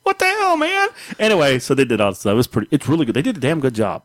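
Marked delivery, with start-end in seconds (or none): 0.0-0.9 high exasperated outburst; indignant. Real, not acted. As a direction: squeak out the outrage.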